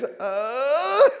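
high, loud, confused "Uh?"; a bewildered squeak of confusion; mock baffled noise; baffled grunt. [name] baffled.

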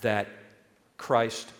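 slow, weighty words; slow and solemn. Acted. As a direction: let each word land slowly. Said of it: "slow emphatic list".

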